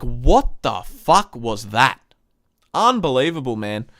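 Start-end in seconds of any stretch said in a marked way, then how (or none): 0.0-2.0 slow, drawn-out what-the-hell disbelief; reliving a fan's stunned fury; incredulous outburst. Retold or imitated. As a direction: spit it out like you can't believe what you saw. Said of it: "I'm like".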